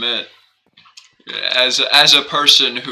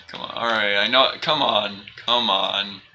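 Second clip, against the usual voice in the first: ordinary talk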